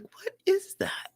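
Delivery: hushed, puzzled whisper. Retold or imitated. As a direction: whisper it as if nobody else is around. Hearing a voice, nobody there.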